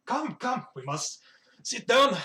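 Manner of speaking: high, emphatic dwarf host; eager invitation. Acted. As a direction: usher guests in excitedly. Dwarf eagerly beckons guests in.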